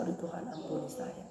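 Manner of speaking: hushed, breathy plea for forgiveness; whispered repentant prayer. Acted. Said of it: "Langsung saya".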